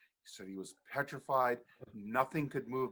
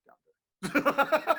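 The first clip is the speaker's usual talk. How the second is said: defiant brother; 14 semitones higher, 9 dB louder than usual. Retold or imitated.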